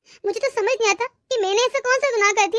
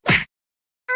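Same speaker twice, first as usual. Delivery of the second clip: breathy gasp of pain; short unvoiced yelp of pain; pained cry. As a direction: cry out as if struck. Being beaten by mother-in-law.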